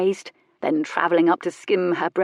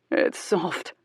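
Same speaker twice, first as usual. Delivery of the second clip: gruff; 3 dB quieter than usual. A narrator's reading. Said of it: "His voice was gruff".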